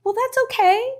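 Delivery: gentle high reassuring tone; kind reassurance. Retold or imitated. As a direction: coax them gently like a kind mentor. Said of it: "I would say".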